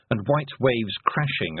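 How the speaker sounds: usual speaking voice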